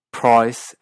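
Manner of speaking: PRICE as Australian 'proice'; Australian accent. Shown as a demonstration. Teacher comparing regional accents, Australian PRICE like 'proice'. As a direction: round the start of Australian PRICE toward 'oi'. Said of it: "en partes de Australia".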